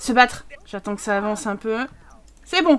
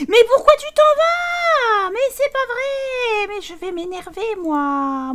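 high, slow, whining protest; whiny complaint; 10 semitones higher, 4 dB louder, slower than usual. Real, not acted. Gamer whines at a character leaving, high and slow. Character walks away mid-fight.

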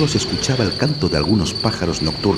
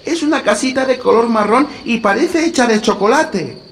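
put-on little girl voice=109; 5 dB louder than usual. A narrator's reading. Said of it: "añadió Gretel".

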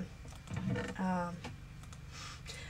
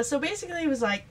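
ordinary talk, nothing marked

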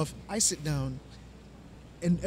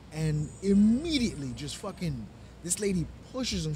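normal talking voice

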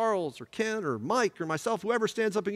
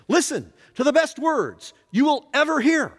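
raised, ringing voice of blessing; pronouncing absolution; 5 semitones higher than usual. Retold or imitated. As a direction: declare it over the whole congregation. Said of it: "I said to you on Sunday".

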